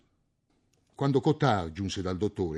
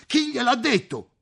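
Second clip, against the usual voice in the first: much higher, startled shout; shouted in alarm; 5 dB louder than usual. A narrator's reading. The landowner shouts at Tarou, alarmed and high. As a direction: blurt it out in sudden panic. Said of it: "gridò il possidente".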